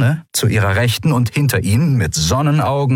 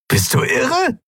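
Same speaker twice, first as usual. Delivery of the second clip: high-pitched, disbelieving question; incredulous; slower than usual. A narrator's reading. Olim to his leader who gives in, incredulous. Crewman stunned by leader's order.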